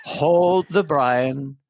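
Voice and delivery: very slow, calm, soothing presidential voice; President Brian. Acted. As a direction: lull them with drawn-out, low-key calm. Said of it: "This is my impression of our next president".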